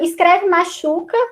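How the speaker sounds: normal talking voice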